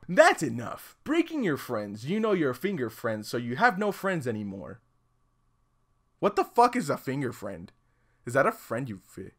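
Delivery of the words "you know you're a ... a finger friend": ordinary delivery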